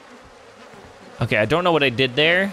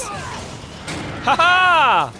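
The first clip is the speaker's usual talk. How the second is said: high gleeful cackle; triumphant laugh. Real, not acted. Player laughing as he escapes the monster, triumphant shout. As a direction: crow in victory.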